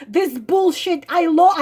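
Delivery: ordinary talk, nothing marked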